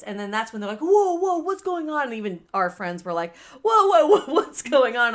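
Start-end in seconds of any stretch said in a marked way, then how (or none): 0.8-2.0 high, loud voice of startled onlookers; alarmed bystanders. Retold or imitated. Voicing onlookers as the shoving starts, alarmed. 3.6-5.1 loud, high imitation of startled friends; alarmed friends. Voicing friends who see the sisters shoving, alarmed.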